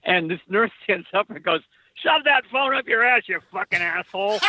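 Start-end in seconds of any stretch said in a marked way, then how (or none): 1.9-4.4 put-on Boston accent. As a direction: bark it like a Boston nurse. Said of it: "in a great Boston accent".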